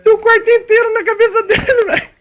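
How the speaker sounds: high-pitched helpless laughter; cracking up mid-sentence; laughing hard. Real, not acted. Laughing at the piled-up prank.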